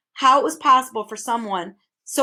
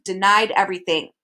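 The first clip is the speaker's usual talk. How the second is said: ordinary delivery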